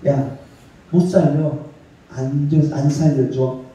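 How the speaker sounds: lightly voiced Jesus turning her away; preacher lightly voicing a cold refusal to the pleading mother. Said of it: "예수님도 어떨 때 좀 냉정할 때가 있으셨나 봐요".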